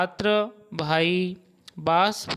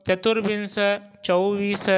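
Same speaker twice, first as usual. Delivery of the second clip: ordinary talk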